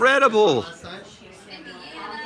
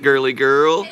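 ordinary delivery

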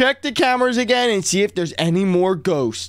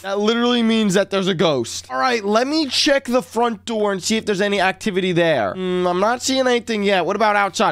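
ordinary talk

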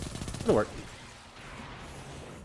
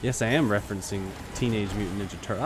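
ordinary talk, nothing marked

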